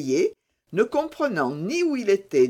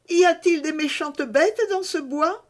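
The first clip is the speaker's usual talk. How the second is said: voix d'enfant bien plus aiguë, un peu craintive; un petit garçon qui a peur du bois; petit garçon inquiet; 12 semitones higher, 4 dB louder than usual. A narrator's reading. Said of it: "il eut un peu peur". (petit garçon inquiet, voix d'enfant aiguë)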